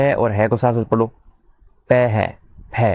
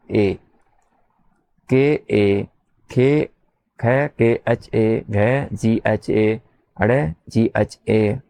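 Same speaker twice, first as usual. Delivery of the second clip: slow dictation; much slower than usual. Shown as a demonstration. Teacher spelling letters out for copying.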